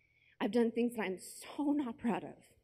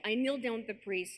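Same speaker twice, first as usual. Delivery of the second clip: normal talking voice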